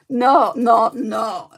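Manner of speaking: loud, abrupt string of no's; emphatic refusal. Real, not acted. Rejecting her own ASMR whisper demo, loudly protesting. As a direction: break out of the hush with a loud protest.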